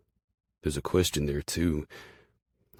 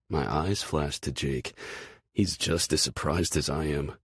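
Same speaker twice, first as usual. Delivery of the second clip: plain reading voice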